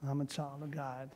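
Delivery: barely audible, timid and unsure; meek mumble. Shown as a demonstration. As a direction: mumble it like you don't believe it. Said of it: "Oh, no, you can't".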